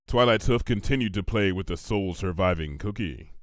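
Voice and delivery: usual speaking voice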